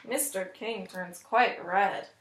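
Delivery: usual speaking voice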